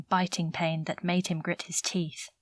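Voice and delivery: ordinary delivery, nothing marked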